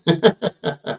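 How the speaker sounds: real run of chuckles; amused laugh. Real, not acted. Laughing about his own taboo name, amused chuckle. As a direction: laugh at your own remark.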